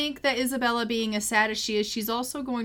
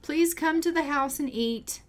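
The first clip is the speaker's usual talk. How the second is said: calling out; 8 semitones higher than usual. Pretend. Calling the horse to eat.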